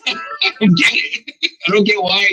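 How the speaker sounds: normal talking voice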